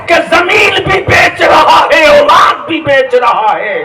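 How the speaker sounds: high, loud scolding cry; preacher voicing Ballia's angry rebuke; shouted rebuke. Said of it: "Ballia भी कहता".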